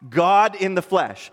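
normal talking voice

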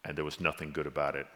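low and very soft, sinking away; somber, hushed. Real, not acted. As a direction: close the thought in bleak quiet. Somber close on Peter's dark Friday.

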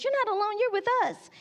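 high, emphatic imitation of a friend protesting; insistent reassurance. Friend insists to lonely kid he has company, emphatically.

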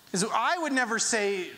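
ordinary talk

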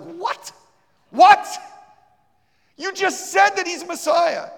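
high, loud incredulous what; shocked disbelief. Retold or imitated. The preacher reacts to her refusal with loud, high disbelief.

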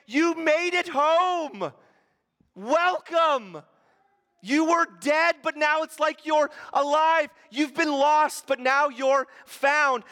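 high, loud and slow, overflowing with joy; joyful shouting father. Acted. Father sprinting down the road welcomes his son home: joyful high shout. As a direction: call it out like a father running to his lost son.

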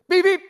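high, loud horn beeps; car horn imitation. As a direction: honk sharply like a little car horn. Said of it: "It's like a car horn".

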